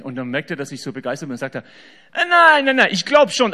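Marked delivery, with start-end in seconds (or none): 2.1-3.5 much higher and louder, hurried denial; the driver panics and backs off fast; alarmed protest. Acted. Taxi driver quickly denying he is a Jesus fan, alarmed.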